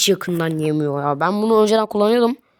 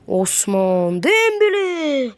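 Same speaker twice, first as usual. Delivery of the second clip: football commentator call; 6 semitones higher, much slower than usual. Acted. Stretched name call.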